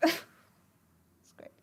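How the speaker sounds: whispered aside; a laughing whisper. Real, not acted. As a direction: breathe it out.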